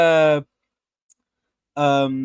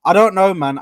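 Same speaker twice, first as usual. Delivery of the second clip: ordinary talk, nothing marked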